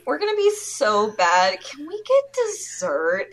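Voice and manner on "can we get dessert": high, polite customer voice. Retold or imitated. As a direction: pitch it up like a timid diner.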